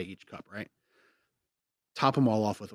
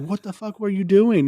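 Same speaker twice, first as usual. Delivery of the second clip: high, loud incredulous yell; mock friends yelling; 11 semitones higher, 11 dB louder than usual. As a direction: yell it in disbelief. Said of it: "They're like".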